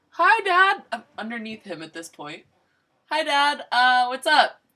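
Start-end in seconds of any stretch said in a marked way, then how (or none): 0.1-0.8 much higher, sing-song fake-innocent hello; innocent act. Faking innocence on phone with dad. 3.1-4.6 innocent act. Retold or imitated. Faking innocence on phone with dad.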